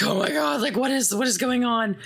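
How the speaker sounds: a touch of hammed-up terror; mock panic. Friends panic after creature attack.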